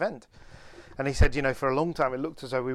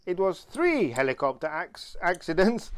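imitated Italian accent, rising and slowing; drawn-out Italian-accented punchline; 5 semitones higher than usual. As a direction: deliver the correction as an Italian would. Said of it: "in an Italian accent, obviously".

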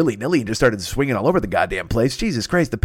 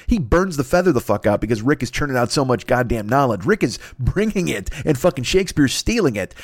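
ordinary talk, nothing marked

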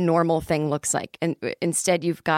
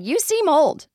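higher-pitched mock complaint; imagined blunt remark; 10 semitones higher, 3 dB louder, slower than usual. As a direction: say it as a high-pitched imagined jab. Imagined older woman's remark.

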